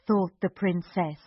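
ordinary delivery, nothing marked

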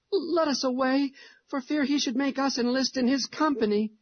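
put-on high, anxious child voice; a nervous little boy urging escape; frightened boy. Hugh's frightened plea continues.

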